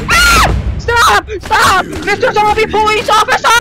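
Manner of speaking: high scared shouting; panicked scream. Real, not acted. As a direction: scream for it to stop. Panicked at zombie.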